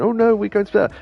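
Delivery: mock crying. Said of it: "she starts crying and going".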